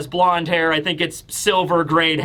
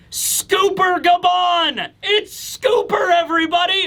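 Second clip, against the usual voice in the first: high, slow, shouted announcement; excited reveal; 11 semitones higher than usual. Real, not acted. Announcing the reveal to viewers, excited high shout. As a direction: hype the big reveal.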